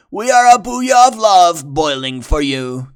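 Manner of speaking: loud, high lovestruck shout; hammy besotted shout; shouted together. Acted. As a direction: shout your love after her. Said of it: "they shouted together".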